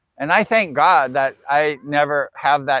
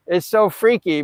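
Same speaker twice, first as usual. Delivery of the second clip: ordinary talk, nothing marked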